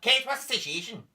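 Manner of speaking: mock breakfast-TV host from Belfast; Eamon Holmes. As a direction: do the TV presenter's catchphrase. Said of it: "that is Eamon Holmes' catchphrase".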